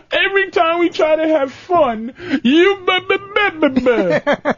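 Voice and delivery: lightly hammed stammering complaint; whiny guy mockery. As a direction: sputter like a whiny boyfriend. Mocking whiny guys.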